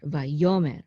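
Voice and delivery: vayomer accented on YO, va-YO-mer; stress on middle syllable. Shown as a demonstration. Teacher showing where the accent is, stress on YO in vayomer. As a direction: put the weight on YO in vayomer. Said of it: "the accent is gonna be here".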